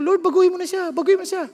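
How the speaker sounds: high, loud mock pleading to God; pleading prayer. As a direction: beg God to change her, half-joking. Retold prayer about his wife. (pabirong pakiusap sa Diyos)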